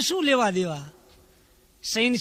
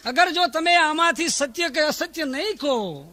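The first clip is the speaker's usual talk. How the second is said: pleading shout; 6 semitones higher than usual. Retold or imitated. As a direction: cry out to the emperor for mercy. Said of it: "ચોબદારે એટલા માટે રાડ નાખી કે".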